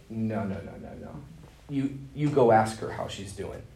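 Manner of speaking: a gentle inner voice holding him back; inner voice of the Spirit. Retold or imitated. Holy Spirit prompting him, retold.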